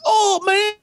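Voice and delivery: high, excited imitation of a Guyanese Creole accent; hammed-up Guyanese "oh, man". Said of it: "he's speaking, like, a Creole accent".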